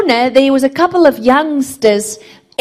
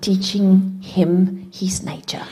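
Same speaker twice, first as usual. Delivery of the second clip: hushed sermon; 8 semitones lower than usual. Acted. Preacher telling the church about God's nature: hushed whisper. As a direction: draw them in with a hush.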